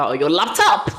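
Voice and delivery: loud, rising punch on the last word; playful loud emphasis. Real, not acted. Host telling viewers what to get, playfully loud. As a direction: call it out big, about to laugh. Jokey emphasis, laughs right after.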